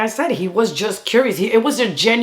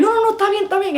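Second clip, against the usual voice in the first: eager reassurance; 12 semitones higher, much faster than usual. Retold or imitated. Reassuring grandma.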